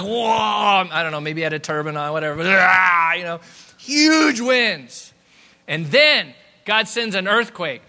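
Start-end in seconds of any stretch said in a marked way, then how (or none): 0.0-0.9 shouted drawn-out whoosh of a cry; loud windblown whoa. Acted. Imitates hair blown by hurricane. 2.5-3.1 long roaring "ah" in a gale; loud mock yell. Imitates being hit by hurricane. 3.8-7.8 loud, high, stretched-out exclamation; booming emphasis. Acted. Dramatizing the hurricane.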